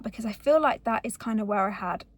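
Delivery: ordinary talk, nothing marked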